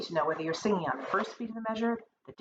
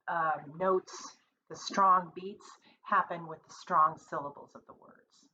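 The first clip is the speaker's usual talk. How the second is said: ordinary talk, nothing marked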